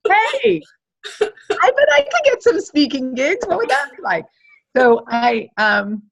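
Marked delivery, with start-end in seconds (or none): 0.1-4.2 high and eager, slowing down; excited idea. Retold or imitated. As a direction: dream aloud with delight. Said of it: "you're like".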